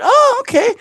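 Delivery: put-on very high, light girlish reply; imitated girl. Girl accepting the twin explanation, imitated very high. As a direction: mimic her with a high lilt.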